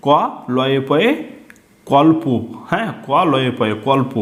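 "কল্প" with ল and প pulled onto ক; blended cluster. Shown as a demonstration. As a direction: spell "ক লয়ে পয়ে" then blend "কল্প". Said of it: "আগের অক্ষরের সঙ্গে টেনে পড়ব". ("কল্প" যুক্তাক্ষর টেনে পড়া)

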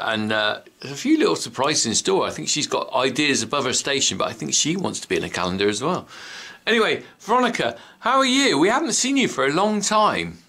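ordinary talk, nothing marked

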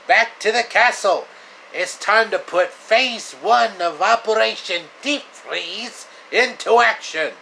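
high, loud gloating cartoon villain; Professor Coldheart voice. Villain Professor Coldheart ordering his frosty sidekick, high gloating shout. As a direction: ham up a cackling cartoon villain. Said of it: "Professor Coldheart".

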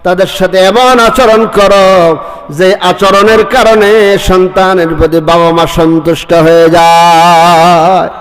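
ordinary talk, nothing marked

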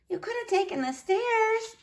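put-on high, soft child's voice; small voice stating the obvious; little niece, deadpan. A narrator's reading. Niece to uncle climbing the walls, small high voice.

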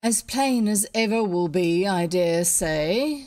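curt. A narrator's reading. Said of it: "said the Pharaoh shortly".

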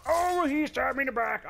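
high, loud yelp of dismay; high-pitched protest at a sudden attack; dismayed outcry. Real, not acted. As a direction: cry out as if caught off guard. Player reacts to being stabbed.

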